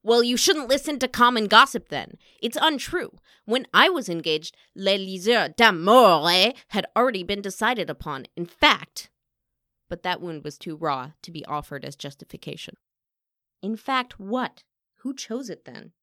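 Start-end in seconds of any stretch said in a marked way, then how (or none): 0.0-9.1 loud, heated self-defence; indignant outburst, very loud; angry at injustice. A narrator's reading. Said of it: "scorch of injustice rise in her throat and anger". 13.6-15.9 higher, pressing questions; pointed interrogation; challenging. Acted. Rival presses broken-off answer.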